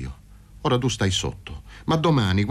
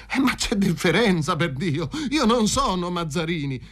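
high-pitched agitated protest; 6 semitones higher than usual. A narrator's reading. Paroni tries to rebel at gunpoint, protesting. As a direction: push back in a raised pitch. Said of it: "tentò di insorgere".

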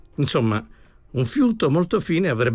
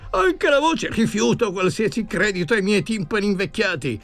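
much higher, quavering, stunned repetition; shaken disbelief; 9 semitones higher than usual. Acted. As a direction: gasp it out in horrified disbelief. Professor hears the ghost speak.

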